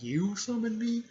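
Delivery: high, loud incredulous question; imitated disbelief. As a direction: shoot up high in mock outrage. Toad in disbelief at summoner.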